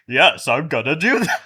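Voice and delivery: loud hammed-up villain taunt; mock villain. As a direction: gloat like a cartoon bad guy. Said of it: "judging by Felicity in this episode, I think here's what happened".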